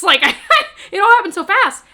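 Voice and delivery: bursting into laughter at the memory; laughing. Real, not acted. Laughing at the weird moment.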